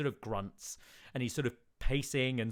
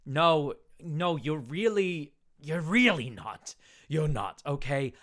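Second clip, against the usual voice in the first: raised, insistent denial; agitated refusal; 5 semitones higher, 8 dB louder than usual. Acted. Town speaker loudly shutting down the envoy's claim. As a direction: talk over him, getting louder.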